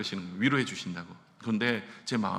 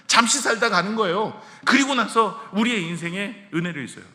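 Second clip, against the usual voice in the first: louder, higher fervent preaching, rising to a peak; preaching at full force; 4 semitones higher, 7 dB louder than usual. Acted. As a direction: preach it out to the whole church, building up.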